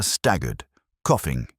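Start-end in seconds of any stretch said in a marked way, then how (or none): none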